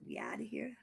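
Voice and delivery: breathy whispered sign-off. Retold or imitated. Retelling a friend leaving for bed, whispered. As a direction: whisper it like sneaking off.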